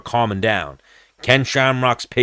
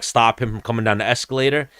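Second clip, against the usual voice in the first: normal talking voice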